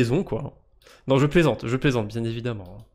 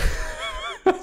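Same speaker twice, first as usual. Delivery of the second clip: high burst of laughter. Real, not acted. Laughs at accidental pun.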